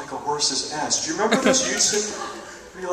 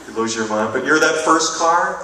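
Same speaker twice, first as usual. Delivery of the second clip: ordinary talk, nothing marked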